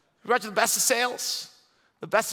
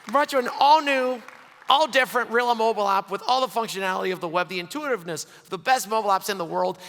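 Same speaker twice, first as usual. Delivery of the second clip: ordinary delivery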